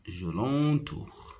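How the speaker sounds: normal talking voice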